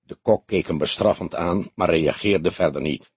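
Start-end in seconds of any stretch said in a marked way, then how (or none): none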